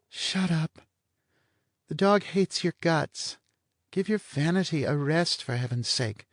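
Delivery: a bored blonde toying with him; lazy blonde. Acted. Blonde teases Vanier about his sex appeal, languid and high.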